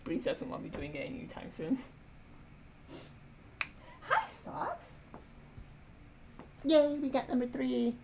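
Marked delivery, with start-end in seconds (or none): 4.0-7.9 high squeaky pet talk. Real, not acted. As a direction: coo at the cat in a tiny high voice. Greeting the cat.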